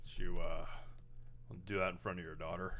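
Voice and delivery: low, quiet tough guy menace; a hint of a gruff cop; lightly voiced Patrick Warburton. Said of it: "Was that Joe somebody".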